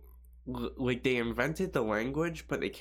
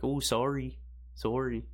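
mock Canadian accent; 3 semitones higher, slower than usual. Canadian apologising, as foreigners imagine, mock Canadian accent. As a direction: put on a polite Canadian "sorry". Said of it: "they think of Canadians are like".

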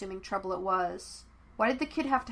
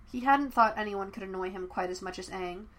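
usual speaking voice

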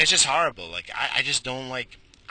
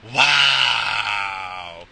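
mock awe; 3 semitones lower, 6 dB louder than usual. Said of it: "You're both gonna be like".

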